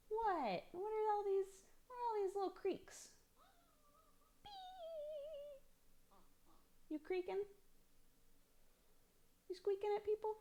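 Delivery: very high, soft cooing questions. Real, not acted. Mom asking her creaking baby, in high soft baby talk.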